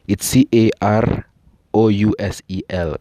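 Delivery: C A R O U S E L named slowly letter by letter; spelled out. Shown as a demonstration. Speaker shows listeners how carousel is spelled, C A R O U S E L spelled out. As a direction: spell C A R O U S E L one letter at a time. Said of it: "I think I've pronounced it".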